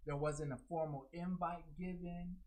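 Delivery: normal talking voice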